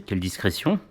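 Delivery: ordinary talk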